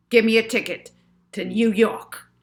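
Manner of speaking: angry villain demanding a ticket. A narrator's reading. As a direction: slap it on the counter.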